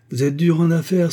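bitter jab at a rival; sour voice. Acted. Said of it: "d'une voix aigre". (d'une voix aigre)

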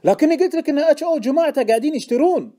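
high, loud, agitated objection; exasperated protest. A narrator's reading. Informant objecting to Deacon's sale, exasperated shouting. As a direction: protest loudly as if unheard.

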